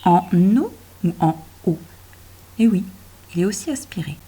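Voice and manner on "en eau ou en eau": "En eau" said twice, first run together, then with a break before "eau"; teacher weighs liaison against a clean break; comparing two readings. Shown as a demonstration. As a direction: test linking and not linking the words. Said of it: "il est aspiré celui-là ou pas?".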